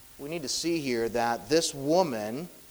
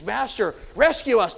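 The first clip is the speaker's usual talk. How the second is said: raised, urgent plea for rescue; frightened disciples pleading, higher and louder; 6 semitones higher, 5 dB louder than usual. Retold or imitated. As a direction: beg him to wake and save them. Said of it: "They're pleading over and over".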